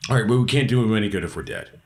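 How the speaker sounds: ordinary talk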